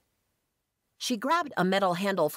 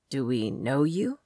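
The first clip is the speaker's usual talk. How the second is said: low-voiced man with English accent; deep English accent; 3 semitones lower than usual. A narrator's reading. Swordsman questions a stranger, deep English accent. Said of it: "He had a deep English accent".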